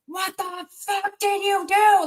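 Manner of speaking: high raging yell at his wife; retold rage. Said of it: "Like, complete just rage".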